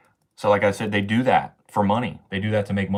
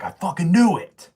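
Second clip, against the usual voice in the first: higher, louder shout of vindication; triumphant outburst; 9 semitones higher, 5 dB louder than usual. Retold or imitated. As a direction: burst out like you were proven right. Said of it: "I was like".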